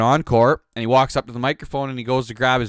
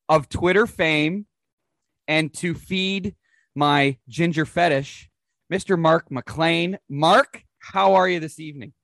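podcast host intro; 4 semitones higher than usual. Acted. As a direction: announce the guest like a ring announcer.

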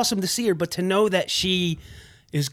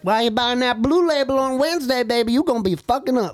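put-on high-pitched older lady's scolding; thrift store lady; 8 semitones higher than usual. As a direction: tease a regular customer as a high-voiced older lady. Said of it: "she'll be like".

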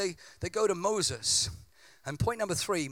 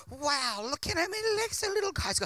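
squeaky little voice; 9 semitones higher than usual. Acted. Mock tiny-voice reaction to ant.

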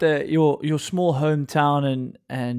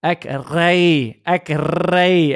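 heavily rolled R, broad Afrikaans; imitating old-school Afrikaans braying; broad Afrikaans accent; 5 dB louder, much slower than usual.

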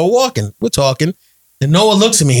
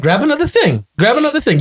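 high eager push to grab more; higher, insistent generous uncle; urging nephew on; 8 semitones higher than usual. Retold or imitated. Uncle urging nephew in store, high eager voice. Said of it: "I'm like".

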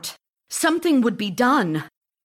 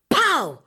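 imitated cork pop; 8 semitones higher, 5 dB louder than usual. Cork popping sound.

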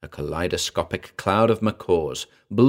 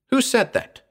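very high, panicky question; alarmed yell. A narrator's reading. Frightened man to unseen speaker, alarmed yell. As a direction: demand it in a high-pitched fright. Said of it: "I yelled".

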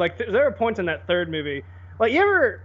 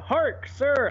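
mock fantasy hero; 7 semitones higher than usual. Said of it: "Playing Skyrim, like".

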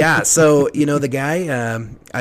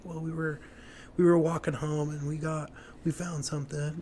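higher, soft and hesitant retold excuse; sheepish excuse; 4 semitones higher, 6 dB quieter than usual. Kid explaining himself to quizzing parents, sheepish. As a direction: retell it as a halting, quiet cover story.

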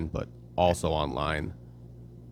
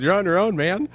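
higher, louder, laughing retort; laughing wife; 12 semitones higher, 6 dB louder than usual. Retold or imitated. Imitating wife laughing as she refuses to help. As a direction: toss it off while laughing. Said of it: "And she starts laughing".

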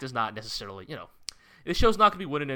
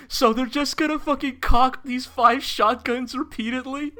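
excited; 13 semitones higher, 7 dB louder than usual. Retold or imitated. Quoting the genius animator's idea.

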